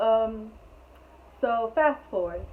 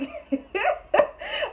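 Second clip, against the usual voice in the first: shrieking laugh, much higher and louder; high burst of laughter; 6 dB louder than usual. Real, not acted. Surprised laugh at compliment.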